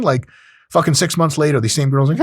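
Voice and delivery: normal talking voice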